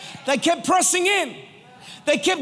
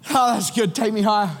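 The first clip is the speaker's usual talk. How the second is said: casual request; 4 semitones lower than usual. Retold or imitated. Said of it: "the next week it's like".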